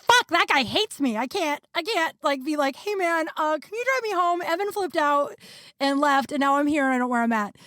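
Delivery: high, urgent imitated plea; retold panic. Stranded woman dreading asking roommate for a ride, high flustered retelling.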